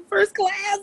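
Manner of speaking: words breaking into a high laugh, slow. Real, not acted. Telling the hosts they flew her first class, laughing. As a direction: squeal it through delighted laughter.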